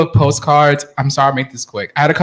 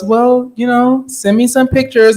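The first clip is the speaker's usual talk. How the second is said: imitating mom; 9 semitones higher than usual. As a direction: draw it out like a mother nudging for pictures. Mom asking for photos, voiced.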